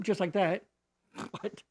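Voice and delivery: ordinary talk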